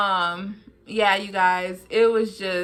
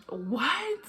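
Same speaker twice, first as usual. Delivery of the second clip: breathless whispered what; stunned whisper; 5 semitones higher, 6 dB quieter than usual. Retold or imitated. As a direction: barely breathe the word. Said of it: "she was just like".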